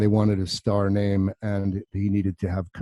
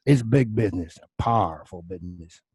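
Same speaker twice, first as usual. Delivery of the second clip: McConaughey-style Texas accent; 2 semitones lower than usual. Shown as a demonstration. Actor showing the line he practised, in a Texas accent. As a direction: say it with a stretched Texan twang. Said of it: "had this accent going on".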